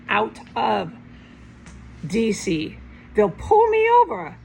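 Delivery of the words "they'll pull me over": high, louder burst of alarm; alarmed outburst about being pulled over. Real, not acted. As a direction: jump up in pitch with alarm. Alarmed exclamation.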